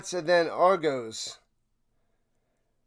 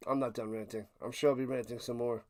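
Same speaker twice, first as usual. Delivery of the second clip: muttered aside; 7 semitones lower, 12 dB quieter, faster than usual. Real, not acted.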